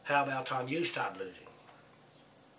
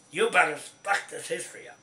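angry; 4 semitones higher, 6 dB louder than usual. Real, not acted. Ranting at the government.

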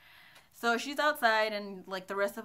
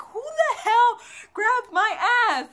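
loud, high, slow outraged demand; retold freaking out; 12 semitones higher, 11 dB louder, slower than usual. Woman demanding to know who groped her, acted freaked-out yell. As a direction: replay a furious demand, stretched out and high. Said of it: "I'm freaking out".

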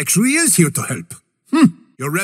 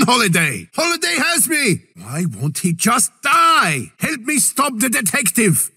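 ordinary delivery

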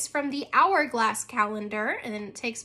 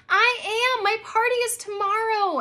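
higher, louder distressed wail; girl wailing to her father about her party; 10 semitones higher, 5 dB louder than usual. Acted. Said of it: "Abby wailed".

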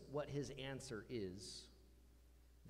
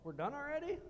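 mock delight; 5 semitones higher, faster than usual. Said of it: "they're like".

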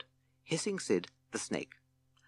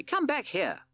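high, louder call; Captain Beaky shouting to Toad; shouted; 4 dB louder, slower than usual. Acted. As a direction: summon him back firmly. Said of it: "shouted Captain Beaky".

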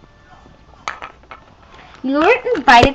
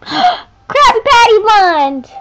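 shocked gasp; 9 semitones higher, 3 dB louder, slower than usual. Acted. Cat doll gasps at newcomer.

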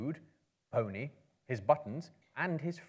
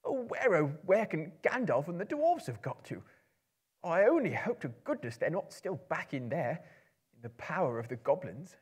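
higher, anxious little hobbit voice; high, louder worried Bilbo; fretful hobbit; 7 semitones higher, 4 dB louder than usual. Acted. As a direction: fret aloud like a lost, worried hobbit. Bilbo frets aloud, lost.

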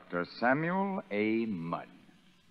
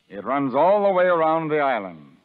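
loud; 3 semitones higher, 8 dB louder than usual. Acted. Sergeant showing the moat.

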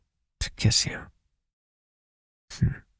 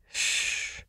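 hush; 9 dB quieter than usual. Acted. Hushing after loud outburst.

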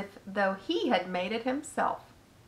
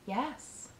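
hushed reply; 4 semitones higher, 8 dB quieter than usual. A narrator's reading. Spider answers Wilbur on waking, hushed. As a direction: answer in a near whisper. Answers a softly asked question.

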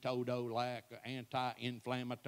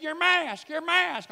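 loud, much higher repeated call; urgent wife; 13 semitones higher, 11 dB louder, slower than usual. Retold or imitated. Wife urgently calling to her husband about his mask, loud. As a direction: alert him fast. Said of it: "She said".

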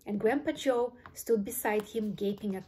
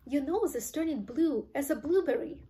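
higher-pitched alarmed exclamation; mild scream; 5 semitones higher than usual. A narrator's reading. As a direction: cry it out in horror. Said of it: "screamed Missus Beauregard".

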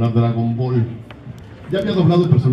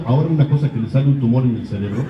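ordinary talk, nothing marked

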